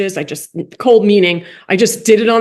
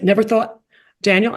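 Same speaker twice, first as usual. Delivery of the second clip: normal talking voice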